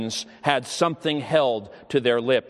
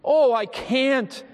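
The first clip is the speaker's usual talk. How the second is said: much higher, slow, breaking out in despair; anguished outburst; 10 semitones higher, slower than usual. Retold or imitated. Dying boy refusing to pray, anguished and slow. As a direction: let the words burst out of him. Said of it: "he broke forth".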